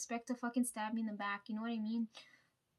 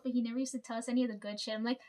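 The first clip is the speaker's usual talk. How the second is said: normal talking voice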